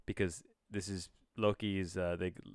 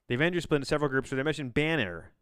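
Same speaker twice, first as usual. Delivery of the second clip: fast reread; 5 semitones higher, 6 dB louder, faster than usual. Acted. Rereading the plot aloud to viewers, rushed and fast. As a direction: rattle it off fast and louder.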